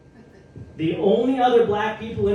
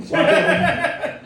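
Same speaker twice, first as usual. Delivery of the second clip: laughing; 12 semitones higher, 4 dB louder, slower than usual. Real, not acted. Laughs mid-story, audience laughs.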